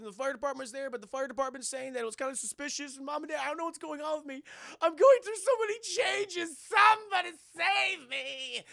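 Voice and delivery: high whine building to a loud wail; freaked-out wailing. Retold or imitated. Clark freaking out to mom and dad, whiny wail. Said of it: "he gets freaked out".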